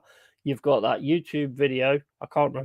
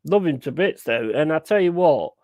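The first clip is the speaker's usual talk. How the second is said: normal talking voice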